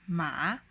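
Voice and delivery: "ma" pressed down low, then bounced up; third tone. Shown as a demonstration. Teacher demonstrating the hardest tone, "ma" dipping then rising. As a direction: dip deep on "ma" and rise out of it. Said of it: "press your voice down and then bounce it back".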